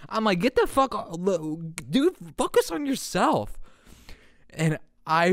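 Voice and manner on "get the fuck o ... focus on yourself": high, dismissive telling-off; defiant snap. Retold or imitated. Dream self telling a classmate off, high and dismissive. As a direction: brush them off, offended.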